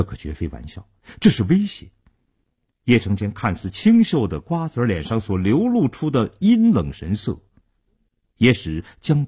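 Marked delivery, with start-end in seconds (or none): none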